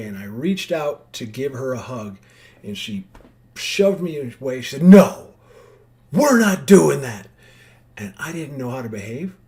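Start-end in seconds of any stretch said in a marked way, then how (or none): 4.8-7.3 loud, slow, forceful no; sharp refusal. Retold or imitated. Therapist loudly refusing the narrator's hug, angry. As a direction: bark it out and shut her down. Therapist shoves her away.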